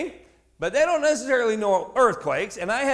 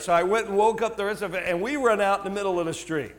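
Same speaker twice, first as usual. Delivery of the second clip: ordinary delivery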